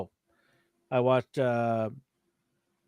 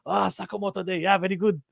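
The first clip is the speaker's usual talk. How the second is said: put-on Japanese waiter accent; 6 semitones higher than usual. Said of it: "Right away, he goes".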